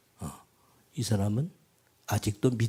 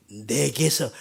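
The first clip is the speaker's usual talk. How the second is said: usual speaking voice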